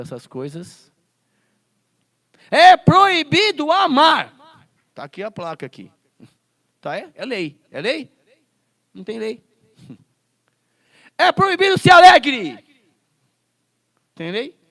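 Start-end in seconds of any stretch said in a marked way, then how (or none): 2.5-4.4 loud, high and very slow, like a sarcastic official decree; booming mock decree, dragged out; mock proclamation. Mocking an absurd law. 11.2-12.6 loud, high and very slow, like a sarcastic official decree; booming mock decree, dragged out; mock proclamation. Mocking an absurd law.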